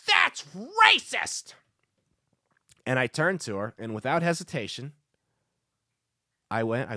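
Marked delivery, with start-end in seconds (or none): 0.1-1.5 very high, screechy mock heckler; heckler's outburst. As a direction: squeal it in outrage, far above your own voice. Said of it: "this lady stands up and goes".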